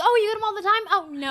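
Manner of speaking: high, loud fake-agreeable reply; mock understanding. Mocking expected reply to dean.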